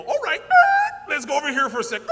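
high, loud playful God voice; breezy joking aside as God; loud high jokey voice of God; mock God. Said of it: "He'll go".

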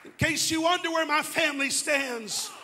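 loud, rising pulpit declaration; preacher boldly declaring his faith in suffering; preaching at full force. Real, not acted. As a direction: proclaim it boldly to the congregation.

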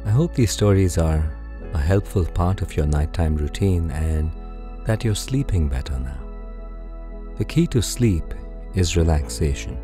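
plain reading voice

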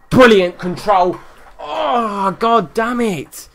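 real frustrated excitement; excited groan. Real, not acted. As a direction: cheer then curse the miss. Near miss in the match.

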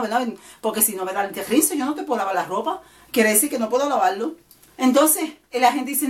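usual speaking voice

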